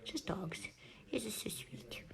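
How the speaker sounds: soft cooing whisper. Real, not acted. Gushing over the puppy, whispering softly. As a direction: whisper it, melting over the pup.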